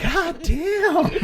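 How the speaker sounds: high laughing god damn; stunned laughing exclaim. Retold or imitated. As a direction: squeak it out amazed. Band's stunned reaction, retold.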